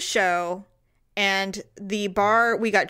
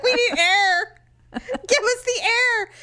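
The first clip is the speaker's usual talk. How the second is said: much higher, slow, crying plea for air; mock desperate plea; 12 semitones higher, slower than usual. Retelling the smelly Uber ride, mock desperate. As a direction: beg for air like you're suffocating. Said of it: "we're like".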